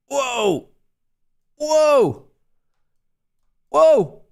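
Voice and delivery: a real jolt of shock as the trade nearly stops out; startled whoa. Real, not acted. Streamer watching his trade nearly get stopped, startled cry.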